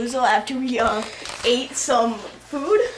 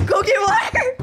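shouting "water" high while laughing; 12 semitones higher, 4 dB louder than usual. Real, not acted. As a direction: yell "Go get water" pitched high through laughter. Panicked laughing yell for water.

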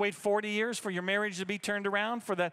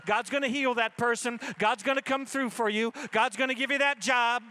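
louder, building to a preacher's peak; full-force preaching; 4 semitones higher, 4 dB louder than usual. Real, not acted. Pastor urging weary believers to keep asking, building forceful preaching. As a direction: drive each promise home, rising in force.

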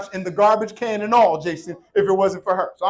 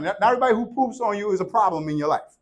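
ordinary talk

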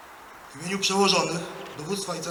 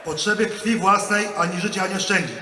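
loud, measured oath line; formal oath dictation; 2 semitones higher, 7 dB louder than usual. Real, not acted. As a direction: declaim each phrase for the ranks.

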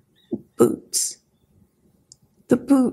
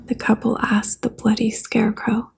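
ordinary delivery

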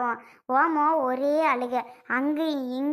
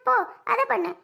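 mock bossy orders; 8 semitones higher, faster than usual. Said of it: "இப்ப என்னடான்னா".

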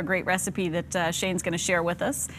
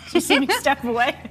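laughing; 5 semitones higher, 4 dB louder than usual. Real, not acted. Dodging a squirt, laughing.

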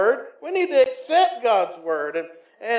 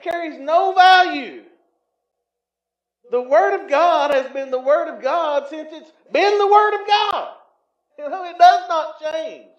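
loud, emphatic preaching; fervent preaching; 7 semitones higher, 8 dB louder than usual. Acted. Preacher insisting God's Word never changes, loud. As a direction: pound it from the pulpit.

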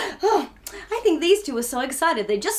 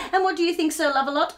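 usual speaking voice

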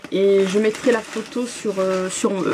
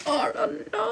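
high, soft gasp of delight; 13 semitones higher, 5 dB quieter than usual. Real, not acted. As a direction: gasp as if the gift is a treasure. Découvre une bougie. (souffle émerveillé, voix aiguë)

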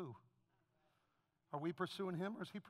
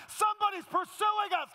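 higher, louder shout on Somebody's; shouted climax; 10 semitones higher, 8 dB louder than usual. Acted. Pastor hitting the sermon's climax to the congregation, shouting. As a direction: yell "Somebody's pursuing us" at the peak of the sermon. Repeated preached climax line.

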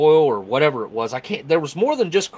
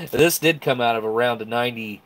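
normal talking voice

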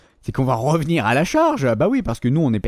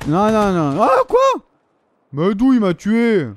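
high, loud startled yelp; shocked cry; 4 semitones higher, 5 dB louder, slower than usual. Real, not acted. Gamer shocked at a sudden death, high yelp. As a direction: cry out as if you were just shot.

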